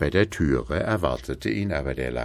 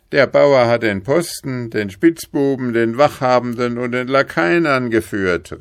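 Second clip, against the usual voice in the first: loud, raised report; calling up; 7 semitones higher, 6 dB louder than usual. A narrator's reading. Guard reporting the trick up to the king, calling up. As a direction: shout the news up to a window. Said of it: "rief der Stockmeister herauf".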